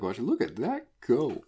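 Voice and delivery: high, slow marvelling; swooping, awestruck mock commentary; amazed. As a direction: draw it out in hammed-up wonder. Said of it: "I'm just amazed".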